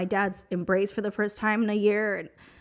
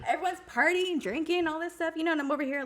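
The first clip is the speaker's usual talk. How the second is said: laughing; 9 semitones higher, 4 dB quieter than usual. Real, not acted. Laughing through the telling.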